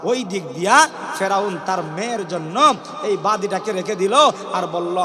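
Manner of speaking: usual speaking voice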